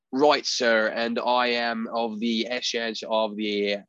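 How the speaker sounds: put-on slow, lightly done British-Boston Maine accent; Maine accent impression. Imitating Robert Pattinson's Maine accent, slow mock British-Boston Maine. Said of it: "I don't even think I can do it".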